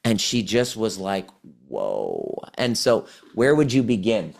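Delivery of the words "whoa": very low, drawn-out whoa; retold stunned whoa. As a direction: imitate being quietly floored. Retold client's mind-blown reaction.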